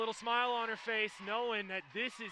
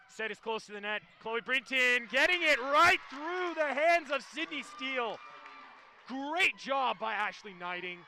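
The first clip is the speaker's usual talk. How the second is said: live play-by-play; 5 semitones higher, 7 dB louder than usual. Real, not acted. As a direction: rally call swelling loud, then easing.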